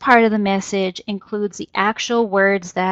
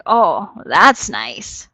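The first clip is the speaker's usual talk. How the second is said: low, slow, dragged-out sarcasm; sarcastic; 3 semitones lower, 4 dB louder, much slower than usual. Shown as a demonstration. Presenter demonstrating a sarcastic reply to a friend's story, dry sarcasm. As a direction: drawl it like you couldn't care less. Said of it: "it's gonna show sarcasm".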